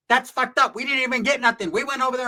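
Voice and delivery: high, whiny mock complaint; lightly voiced whiny friend; high Dwarfwood voice. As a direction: pitch it up and whine.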